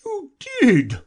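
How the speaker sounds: loud, high, wailing retort; Mock Turtle. A narrator's reading. Mock Turtle contradicts Alice, loud high wail. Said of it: "said the Mock Turtle".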